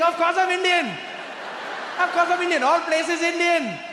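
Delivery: put-on very high, loud, gloating Indian accent. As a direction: crow over a prank in an Indian accent. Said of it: "you, you Indian?".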